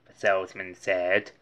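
plain reading voice

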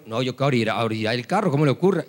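low, hurried grumble of disbelief; lightly acted low-voiced protest; reluctant objection. Driver objecting to orillar el carro, reluctant low grumble. Driver argues back to himself.

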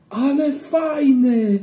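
put-on high, slow, delighted cry of a boy; excited shout. A narrator's reading. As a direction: shout out the thrill, drawn out and high. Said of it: "krzyknął Kleofas".

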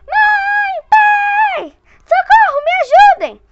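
high, loud panicked scream for help; boy screaming to his parents in terror. Acted. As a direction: scream for mom and dad. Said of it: "começou a gritar de pavor".

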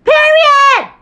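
loud high shout; shouted exclamation. Real, not acted. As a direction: belt it out in triumph. Triumphant exclamation opening video.

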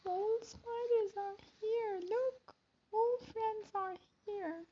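hushed announcement. Acted. Spiders arrive, hushed.

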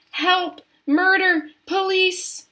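high, loud single-word screams; piercingly high cries, one by one; screamed alarm. A narrator's reading. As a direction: scream for help as a panicking mother. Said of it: "screamed Missus Gloop".

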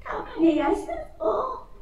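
high, breathless rustic blurt; breathless outburst. Acted. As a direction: gasp it out, stunned and high. Country man bursting out to master.

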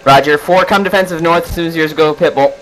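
normal talking voice

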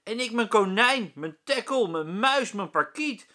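put-on high, loud kids shouting over each other; shouting children. A narrator's reading. The children shout their pets at the teacher, loud. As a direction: call out pets one after another. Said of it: "Iedereen schreeuwt door elkaar".

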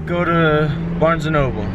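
ordinary delivery

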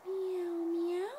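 high, drawn-out kitty meows; high storybook cat sound. A narrator's reading. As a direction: meow like a picture-book kitty. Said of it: "said the cat".

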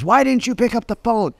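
high, mock-furious client demanding answers; imitating angry client. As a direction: play the entitled client chewing him out. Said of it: "a client that calls and says".

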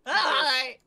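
high, loud put-on hello; high-voiced fake uncle; Craig. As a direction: greet as a silly pretend uncle. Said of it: "this is my Uncle Craig".

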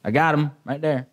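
low, casual throwaway line; passionless, offhand. Shown as a demonstration. Preacher mocking a passionless announcement of the find, offhand. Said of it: "I can't say that with, like".

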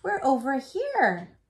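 high, loud call back. Acted. Twins calling back to grandfather, loud. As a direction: wave him over with your voice. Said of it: "together they called".

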